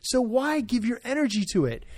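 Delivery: forceful rising insistence; emphatic. Real, not acted. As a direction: drive it home like a preacher. Pastor pressing the point home.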